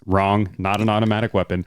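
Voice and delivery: ordinary talk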